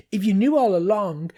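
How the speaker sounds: high angry shout from a little machine. A narrator's reading. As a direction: yell it, indignant. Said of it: "the little machine shouted".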